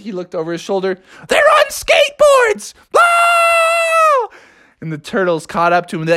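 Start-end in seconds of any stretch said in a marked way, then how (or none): 1.3-4.4 very high shrieking warning; panicked frog. Acted. Froggy warns friends about the turtles, shrieking. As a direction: squeal it up to the guys, ending in a cartoon yelp.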